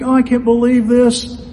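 high, loud wail imitating an upset woman; mock crying. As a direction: act out her tearful disbelief. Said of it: "and she's crying".

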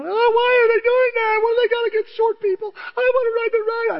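put-on high, loud wailing little girl begging; crying little girl. Little girl sobbing to him at the ride. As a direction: wail like a heartbroken child who can't ride. Crying child voiced in story.

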